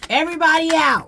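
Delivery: high, fast, loud shout to clear the room; yelled eviction order; loud command. Retold or imitated. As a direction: order everyone out at full volume.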